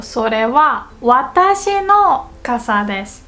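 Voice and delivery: 私の lifted after a pause; stress on 私の. Shown as a demonstration. Teacher showing wa emphasis, stress on 私の. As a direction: set off それは, then punch 私の. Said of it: "what comes after the wa particle is".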